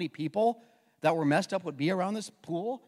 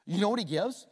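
normal talking voice

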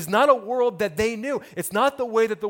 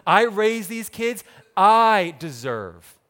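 self-righteous demand; 7 dB louder, much slower than usual. Acted. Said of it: "they start thinking to themselves".

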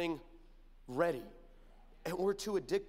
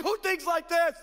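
incredulous; 9 semitones higher, 5 dB louder than usual. Retold or imitated. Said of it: "I'm like".

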